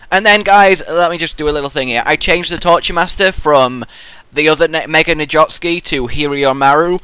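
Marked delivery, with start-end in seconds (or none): none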